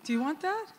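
doctor offering full hearing, lightly voiced and breezy; cheery doctor. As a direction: toss it off like it's no big deal. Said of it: "And he said".

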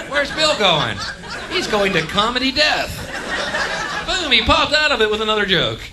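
high, excited running commentary; mock excited spectator. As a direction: call his act like a thrilled onlooker. Voices excited spectators of his act.

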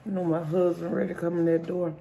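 talking while chewing; words through a bite of food; mouth full. Real, not acted. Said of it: "See if I can eat it in four minutes".

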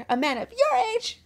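high-pitched scandalized outburst; mock movie shouting. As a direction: cry it out in hammed-up outrage. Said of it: "she just starts shouting".

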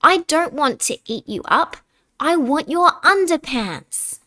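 T-Rex roaring. A narrator's reading. T-Rex roars at the cavemen for their pants, loud and high. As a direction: bellow it like a greedy T-Rex. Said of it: "he roared with deafening rants".

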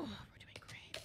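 hushed whisper; soft sneaky whisper. Real, not acted. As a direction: whisper it while hiding. Sneaking past killers.

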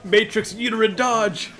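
mock announcer. Announcing a silly move name.

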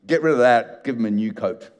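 ordinary talk, nothing marked

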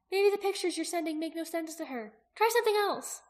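high, thin voice, climbing at the end; thinned-out voice. A narrator's reading. Aki advising Jaqen on his sending, high thinned-out voice. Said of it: "Aki's voice was thinned out".

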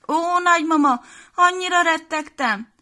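high, loud acted little-girl sob; mock sobbing. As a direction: Piroska cries to her rescued grandma, mildly sobbing. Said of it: "zokogott Piroska".

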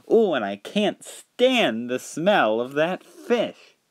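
high, slow, swooping complaint; drawn-out theatrical revulsion; disgusted. Acted. P T Boomer complaining about the fish smell, disgusted.

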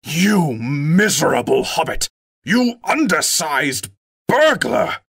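shout, sputtering with rage; furious shout. A narrator's reading. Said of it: "he shouted at a loss for words".

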